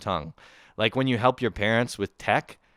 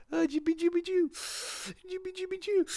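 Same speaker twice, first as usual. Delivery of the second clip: put-on very high cooing baby-talk gibberish; oblivious mother. Smoking mother to her toddler, oblivious cooing. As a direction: coo nonsense at a baby, hammed up. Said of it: "just oblivious, just like".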